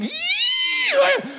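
very high-pitched "eee" squeal; comic falsetto scream; mock shriek. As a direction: squeal sky-high as if a mouse ran past. His shriek as mouse scurries.

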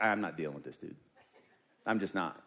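mock pilot, low and curt; gruff pilot impression. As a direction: drop low and brush him off like a fed-up pilot. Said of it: "the pilot was like".

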